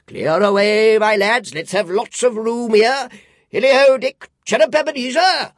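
very loud, high hearty calls; jolly old man. A narrator's reading. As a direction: whoop up the lads. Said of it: "cried old Fezziwig".

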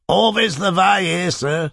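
Cockney driver. Acted. Cab driver explaining to Mister Tuggs in broad Cockney.

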